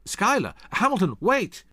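high, loud alarmed call; shouting after him. Acted. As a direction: shout to stop a friend walking away. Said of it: "Troop shouted after him".

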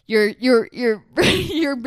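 half-laughing, half-alarmed at herself; laughing self-scolding. Retold or imitated. Alarm goes off, she scolds herself, laughing and loud. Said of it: "The alarm went off and then I was like".

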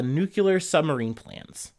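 normal talking voice

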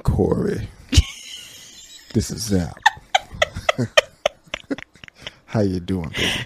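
low sultry murmur; low husky whisper. Pretend. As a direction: murmur it like a seduction. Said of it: "Why do you keep saying her name like that".

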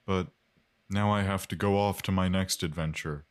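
lightly voiced guest character. Keeps the Mike voice.

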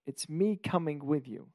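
ordinary talk, nothing marked

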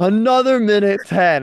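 high, slow, drawn-out mock complaint; exasperated disbelief. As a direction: stretch it out in comic dismay. Retold dismay at time left.

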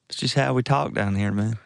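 mock Southern accent. Podcaster joking to his cohosts in a hammed-up Southern accent. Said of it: "your Southern accent".

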